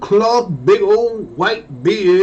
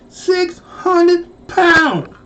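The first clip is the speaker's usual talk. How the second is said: very slow, higher, savour each word; drawn-out disbelief; 9 semitones higher, much slower than usual. Retold or imitated. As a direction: stretch it out in awe. Marvelling at his weight.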